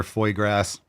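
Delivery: "foie gras" said as "foy grass"; deliberate mispronunciation. Shown as a demonstration. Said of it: "I'll call it foie gras".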